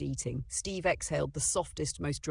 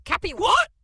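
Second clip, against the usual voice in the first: very high, loud baffled shout; 12 dB louder than usual. Acted. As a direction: bark out the confused question. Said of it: "Grandpa shouted".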